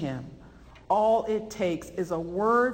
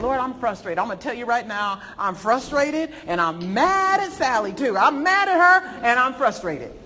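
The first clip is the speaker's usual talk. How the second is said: frustrated prayer; 5 semitones higher, 6 dB louder than usual. Retold or imitated. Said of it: "come to him frustrated".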